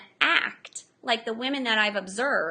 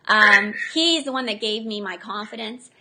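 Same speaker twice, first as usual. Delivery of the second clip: usual speaking voice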